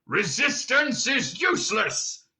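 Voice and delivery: slow, high-pitched bellow; Vogon guard shouting. A narrator's reading. Guard interrupting the prisoners, shouting slowly. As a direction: boom it out mechanically, word by word. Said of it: "the guard shouting again".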